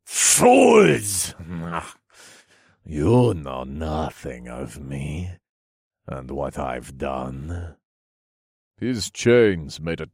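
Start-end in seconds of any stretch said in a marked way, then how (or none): 0.0-1.3 high, loud, furious cry; villain's outburst. A narrator's reading. Mad villain rages at heroes. 2.8-7.8 low, slow, sinister voice; villain's dark, softened menace; menacing villain. A narrator's reading. Mad villain sneers at heroes.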